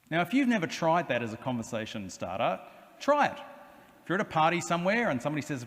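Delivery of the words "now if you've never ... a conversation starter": ordinary delivery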